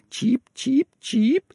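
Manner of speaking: very high, chirpy bird sounds; bird chirp. Acted. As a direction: peep like a tiny bird. Birds chirping.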